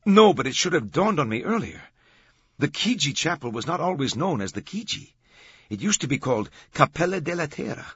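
high, loud explaining guide; excited docent. A narrator's reading. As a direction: explain it like a guide with a sudden realization.

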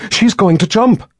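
high, loud alarmed shout. Acted. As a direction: yell a sudden warning. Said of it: "Nikki shouted".

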